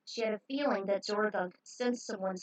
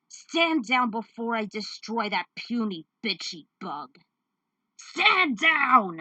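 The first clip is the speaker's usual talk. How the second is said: louder, building to a bellow; villain threat; 7 dB louder, slower than usual. Acted. Villain choking the woman, threat building to a roar. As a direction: growl the demand then roar it.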